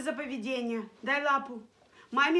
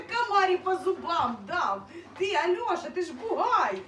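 loud, higher-pitched playful scolding; boisterous teasing; 4 semitones higher, 6 dB louder than usual. Real, not acted. Owner ribbing her big dog Альоша, boisterous teasing. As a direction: tease a rowdy pet at full volume.

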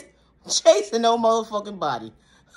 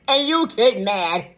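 ordinary delivery

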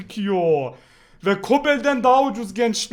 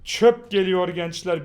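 ordinary talk

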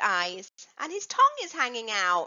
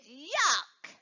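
disgusted. Shown as a demonstration.

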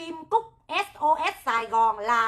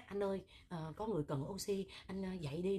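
much softer, lower, gentle coaxing; gentle soft wake-up; 6 semitones lower, 14 dB quieter than usual. Retold or imitated. Mock-gentle wake-up call to a tired team member, much softer. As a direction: coax him awake ever so softly. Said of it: "dòng cái thứ mà nhẹ nhẹ".

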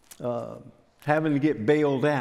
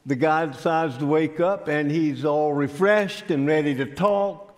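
ordinary talk, nothing marked

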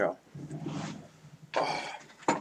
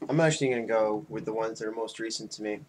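usual speaking voice

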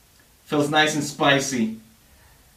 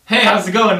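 ordinary delivery

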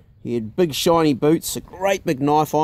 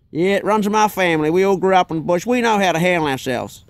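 Texan brag; 3 semitones higher than usual. Retold or imitated. Imitating Chuck.

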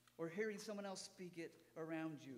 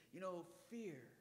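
ordinary talk

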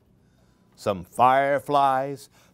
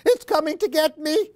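much higher, fast frightened pleading; panicked cry for mama; 13 semitones higher, 3 dB louder, faster than usual. Acted. Baby Chick panicking and calling mama. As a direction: gabble it out in panic. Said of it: "he cried".

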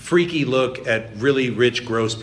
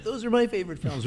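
high, gushing nerd voice with laugh; nerdy gushing; 11 semitones higher, 4 dB quieter than usual. Retold or imitated. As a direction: gush like a nerd fan. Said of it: "all the nerds are like".

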